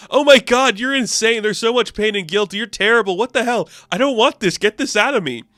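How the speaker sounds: high, loud, frantic recoil; horrified panic. Retold or imitated. Randall panicking at Mark's pain, high and loud. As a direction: beg to make it stop.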